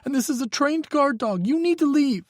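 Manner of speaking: put-on high, tense young voice; a frightened young man pleading on the phone; young man, scared. A narrator's reading. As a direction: Micah telling Hawk his ex is dangerous, high and scared. Micah's voice, bluffing scared.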